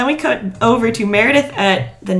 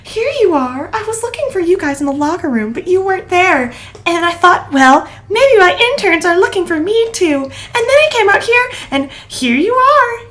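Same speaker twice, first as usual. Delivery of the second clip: perky resident voice; 10 semitones higher, 3 dB louder than usual. Retold or imitated. Mimicking Sydney's perky speech.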